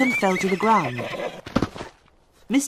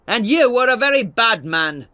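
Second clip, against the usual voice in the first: loud, higher, hurled insult; angry shout; 6 semitones higher, 8 dB louder than usual. A narrator's reading. Tulliver insulting lawyer Wakem, angry shout. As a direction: shout it back in his face. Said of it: "Tulliver shouted".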